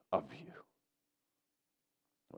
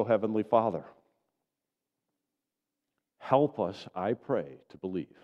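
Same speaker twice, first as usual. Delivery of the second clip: prayer; 4 dB louder than usual. Real, not acted. Pastor praying over the congregation to believe, earnest. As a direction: pray it humbly to God.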